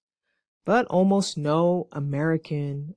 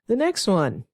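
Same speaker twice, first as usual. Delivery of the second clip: usual speaking voice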